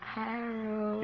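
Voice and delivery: soft, hushed reproach; quiet, reluctant little objection; coy protest. Acted. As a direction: murmur his name in gentle protest. Coyly resisting a kiss.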